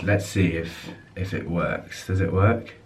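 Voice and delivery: ordinary delivery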